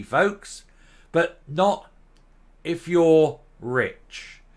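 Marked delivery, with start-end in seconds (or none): 1.1-4.4 slow punchline. Acted. Sarcastic punchline on the rich.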